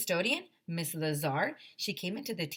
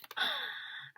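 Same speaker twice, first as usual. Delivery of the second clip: airy high gasp of relief; happy gasp; 7 dB quieter than usual. Real, not acted. Teacher reacting to the happy ending, airy gasp. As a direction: gasp in delight.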